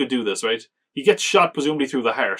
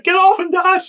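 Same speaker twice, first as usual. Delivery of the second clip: put-on much higher, loud kid's shout; son yelling at dad; 8 dB louder than usual. The son screams at his father to stop, high and loud. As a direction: shriek it like a scared boy pulling his dad off. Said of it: "He's like".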